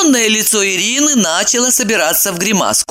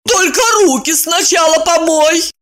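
commanding; 11 semitones higher than usual. Acted. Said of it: "приказала Ирина".

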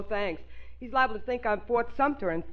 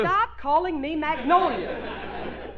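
exasperated protest; 5 semitones higher, 5 dB louder, much slower than usual. Real, not acted. Wife protests the nickname.

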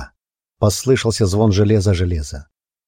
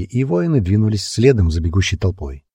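plain reading voice

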